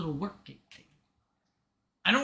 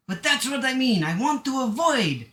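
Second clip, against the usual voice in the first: Mexican accent attempt; 5 semitones higher than usual. Shown as a demonstration. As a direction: insist in a put-on Mexican accent, pitched up. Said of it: "want to do a Mexican accent".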